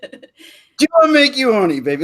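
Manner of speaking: high, slow British Austin Powers line; Austin Powers accent. Retold or imitated. Man surprises a friend in Austin Powers accent. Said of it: "doing the accent".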